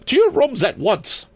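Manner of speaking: very high, commanding matron voice; stern order. A narrator's reading. Principal orders pupils off the roof, stern. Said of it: "Miss Perkman ordered again".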